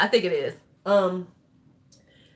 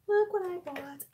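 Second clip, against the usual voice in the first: breathy conspiratorial whisper; 9 semitones higher, 3 dB quieter than usual. Real, not acted. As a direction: whisper it like a secret. Repeats it whispered.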